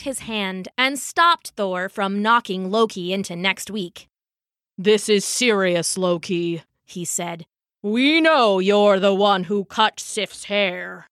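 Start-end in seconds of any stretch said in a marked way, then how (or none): 4.8-6.6 grave father-god voice; stern Odin. Acted. Odin stopping the fight, stern grave voice. 7.8-11.1 slow, weighty accusation; stern Odin. Acted. Odin accusing the trickster, slow stern voice.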